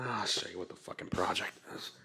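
hushed secretive whisper. Real, not acted. Secretive aside about project.

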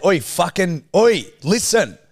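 mock-rough call to a good mate. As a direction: bark it to get a mate's attention. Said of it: "I would say".

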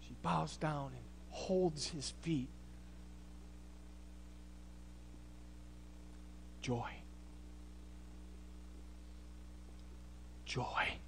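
near-silent awe; reverent hush. Acted. Preacher letting the congregation sit in joy, hushed and low.

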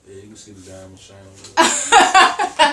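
loud laughing boast. Real, not acted. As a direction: laugh while showing off. Laughs while boasting diamond.

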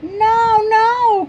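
high, loud protest; crying out. Acted. Froggy protests loudly to his mother, high cry. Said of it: "cried Froggy".

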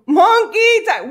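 excited. Acted. Announcing a dance for a super chat.

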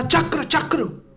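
summoning call. Acted. Mahaprabhu summons the chakra.

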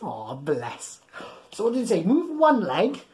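plain reading voice

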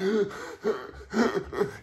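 high, wheezy mock gasps; struggling gasps for air, acted out; imitated breathless gasping. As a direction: act out waking up unable to breathe. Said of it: "I can't breathe. I'm like".

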